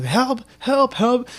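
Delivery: high mock cry of help, from off in the back; mock yell for help. Retelling a TikTok, mock yell for help from the boy. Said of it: "you just hear someone just yelling in the back".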